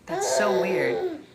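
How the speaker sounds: high, slow, drawn-out "so weird"; exaggerated weird. Real, not acted. Mother reacts to a viewer's comment, slow and high. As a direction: stretch it out in mock disbelief.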